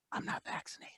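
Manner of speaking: under their breath. Retold or imitated. People admitting to him they are not vaccinated, whispered. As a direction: breathe it out like a guilty secret. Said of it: "under their breath".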